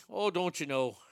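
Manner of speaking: lightly mocked Minnesota accent; teasing hint of a Minnesota accent. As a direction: put on a touch of Minnesota twang. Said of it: "Minnesota accents are so annoying".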